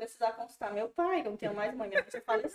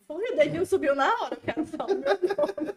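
imitating husband; 7 semitones higher, 7 dB louder than usual. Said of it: "Faz assim".

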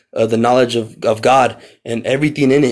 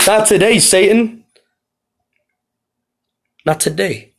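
high, raised rebuke; defiant; 7 semitones higher than usual. Acted. Preacher rebuking Satan in the morning, defiant. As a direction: throw it out as a challenge.

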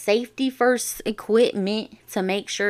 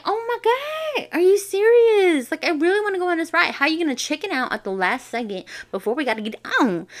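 much higher, louder, fast indignant; outraged disbelief; 8 semitones higher, 3 dB louder than usual. Retold or imitated. Sister to brother who chickened out, high and indignant. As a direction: complain in shocked disbelief.

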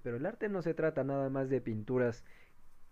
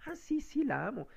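high, offhand "ah sí, sí, la amo"; half-hearted love; 13 semitones higher than usual. Shown as a demonstration. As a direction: shrug it off lightly. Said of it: "No, no, no, no".

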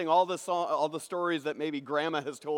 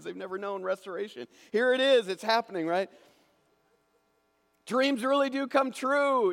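ordinary talk, nothing marked

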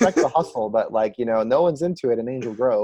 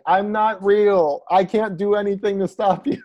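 mock PSA voice; 6 semitones higher than usual. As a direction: play a fake-sincere hero in a public service ad. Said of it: "style P S A of him just being like".

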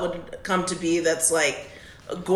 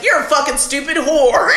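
mock mean waiter; 7 semitones higher, 4 dB louder than usual. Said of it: "supposed to be mean to you".

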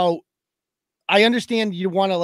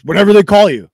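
louder, flat dismissive brush-off; clipped brush-off from the court officer; curt officer brush-off; 9 dB louder than usual. Retold or imitated. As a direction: bark it back like a bored official.

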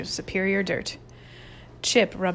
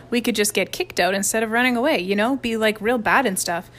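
ordinary delivery, nothing marked

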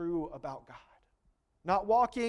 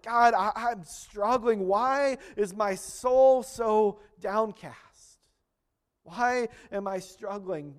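louder, slow, pleading cry to God; pleading prayer; 4 semitones higher, 7 dB louder, slower than usual. Retold or imitated. Voiced prayer in sermon.

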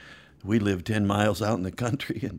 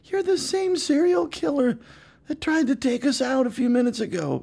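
much higher, hammed-up kid outrage; mock outrage. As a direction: squeal it like a betrayed child. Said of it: "And we're thinking".

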